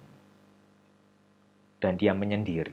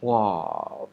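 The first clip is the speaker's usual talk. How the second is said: ordinary delivery, nothing marked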